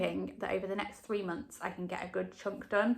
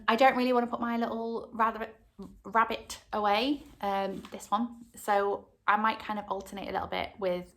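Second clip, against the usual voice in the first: usual speaking voice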